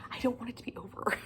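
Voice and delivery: soft whispered plea; heartfelt whisper about the series ending; whispered lament. Real, not acted. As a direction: whisper it, dreading the end. Series ending, near tears.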